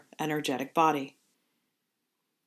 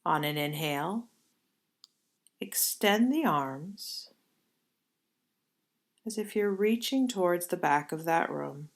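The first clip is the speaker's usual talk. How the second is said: guided meditation. Acted. Leads yoga nidra movement.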